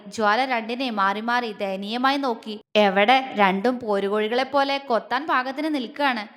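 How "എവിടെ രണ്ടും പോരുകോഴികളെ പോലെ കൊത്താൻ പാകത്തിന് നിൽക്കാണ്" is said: ordinary delivery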